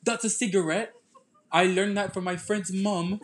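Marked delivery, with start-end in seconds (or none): 0.0-0.9 high, slowing put-on British; mock British self-defence, pitched up; British accent. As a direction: explain it in a fake British accent. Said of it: "Let us hear your British accent".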